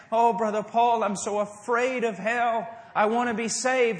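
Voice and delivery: mock plea from someone afraid of hell; imitating a frightened seeker. Seeker begging the preacher after service, in a loud pleading voice.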